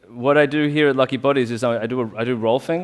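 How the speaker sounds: usual speaking voice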